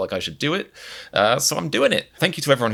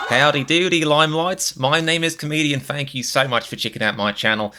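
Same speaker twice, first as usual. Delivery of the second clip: higher, louder mock Australian accent greeting; 6 semitones higher, 3 dB louder than usual. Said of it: "Why am I talking in an Australian accent?".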